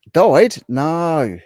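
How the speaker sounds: high, loud shocked gasp of a question. Real, not acted. Streamer reacting to a chat comment, shocked. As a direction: react in disbelief to the news.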